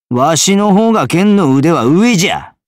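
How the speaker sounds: loud, high boast of his skill; proud Tosa swordsman shouting his boast; boastful shout. Acted. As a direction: crow that you're the better swordsman.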